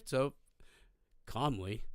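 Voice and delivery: usual speaking voice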